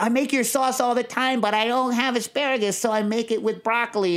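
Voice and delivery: eager confession. Retold or imitated. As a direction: imitate an earnest fan owning up to the chef. Retells his earnest confession to chef.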